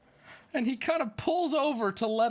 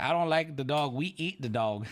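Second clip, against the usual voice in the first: low imitated Chinese-accented driver; 6 semitones lower than usual. Driver telling passengers about dogs, imitated Chinese accent. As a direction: lightly put on a Chinese accent. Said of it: "with a very heavy Chinese accent".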